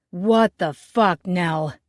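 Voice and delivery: slow, loud furious challenge; loud angry demand. Acted. Angry man bursting in, yelling at the chef, loud and slow. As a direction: storm in and bellow at her. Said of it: "his voice louder than all hell".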